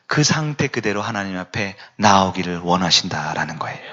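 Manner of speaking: hushed whispered plea, no voice. Real, not acted. Preacher whispers that God wants them as they are, unvoiced whisper. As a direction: lean in and whisper the invitation.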